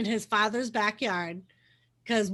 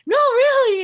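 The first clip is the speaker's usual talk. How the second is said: much higher and louder earnest insistence; pitched-up retold plea to be believed; insisting; 12 semitones higher, 9 dB louder than usual. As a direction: push through her disbelief. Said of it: "and he's like".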